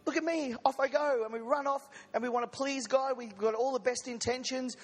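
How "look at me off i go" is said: put-on high, eager little boy voice, lightly done; little Johnny. Preacher plays a carefree child leaving God, high playful voice. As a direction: squeak it out like a kid running off. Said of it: "We're like little Johnny".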